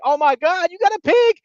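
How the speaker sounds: very high, loud delighted cry; excited squeal. Retold or imitated. Cheney seeing the pig, high excited squeal. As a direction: squeal with surprise and joy. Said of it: "She's like".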